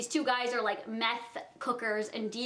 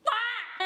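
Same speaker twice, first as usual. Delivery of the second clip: mock shriek; 10 semitones higher than usual. Retelling the tense standoff.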